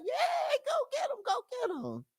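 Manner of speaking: mock cheer, very high and squealing; cheerleader. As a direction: shriek it like a pom-pom cheerleader. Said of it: "put on your pom-poms and be like".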